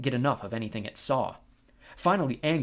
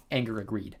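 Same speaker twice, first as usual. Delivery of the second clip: plain reading voice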